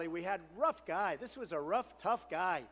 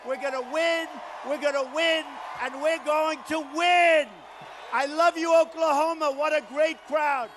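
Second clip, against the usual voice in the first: rally preaching; 5 semitones higher, 9 dB louder than usual. Acted. Candidate rousing the Oklahoma crowd, loud shouted rally. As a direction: whip the crowd up, full force.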